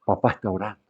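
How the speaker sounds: soft hushed shh, papá is praying; whispered warning to running children; mother's hushing whisper. Retold or imitated. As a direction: hush the kids like a mother at the door. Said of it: "mamá decía".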